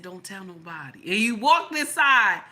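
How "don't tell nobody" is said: much softer, low and confiding; hushed secret. Retold or imitated. Man at the airport tells her, softly confiding. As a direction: murmur it like a secret between friends. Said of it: "He said".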